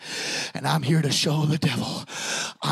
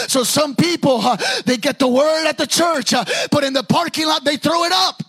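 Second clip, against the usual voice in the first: preaching, building; 10 semitones higher, 9 dB louder than usual. Acted. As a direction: build from firm to loud, high pulpit shouting. Preaching builds to full force.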